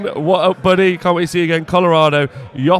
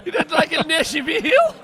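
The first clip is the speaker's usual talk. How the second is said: put-on higher, laughing Southern American accent; playful Southern American twang, giggling; mock American accent; 9 semitones higher than usual. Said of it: "That's my American accent".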